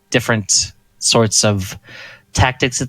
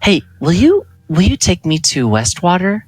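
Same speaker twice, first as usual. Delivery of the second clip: mildly eager, higher-pitched ask; interrupting request; 8 semitones higher than usual. Acted. Larissa interrupting him to ask a favor, higher and insistent. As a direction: cut in with a hopeful question. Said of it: "Larissa kinda interrupts him".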